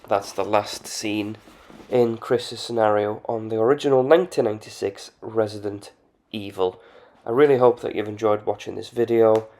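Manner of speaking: ordinary delivery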